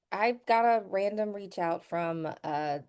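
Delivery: usual speaking voice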